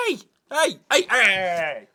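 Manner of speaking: loud, high repeated hey; sharp loud calls of hey; shouting at the dog. Real, not acted. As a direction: yell to stop the dog.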